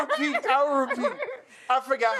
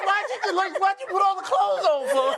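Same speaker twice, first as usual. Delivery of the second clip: high incredulous question; disbelieving why; 11 semitones higher than usual. Retold or imitated. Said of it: "I'm like".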